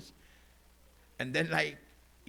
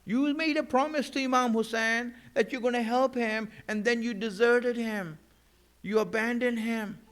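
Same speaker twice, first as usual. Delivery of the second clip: raised, insistent accusation in a preacher's retelling; accusing; 6 semitones higher, 3 dB louder than usual. Retold or imitated. Preacher retells her rebuke of the people who deserted Hussain, accusing. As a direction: press the charge at a higher pitch.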